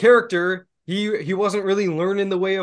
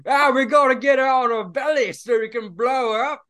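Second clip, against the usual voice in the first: higher mock Australian accent; light Australian accent; 6 semitones higher than usual. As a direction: toss it off in a jokey Australian accent. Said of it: "he's fucking Australian. He's like".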